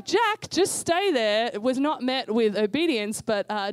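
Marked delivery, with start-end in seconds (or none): 0.0-1.6 put-on high, slow urgent call to child. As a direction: call out, stretching each word. Parent calling to child.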